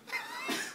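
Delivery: high-pitched burst of laughter. Real, not acted. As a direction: squeal with laughter. Friends crack up.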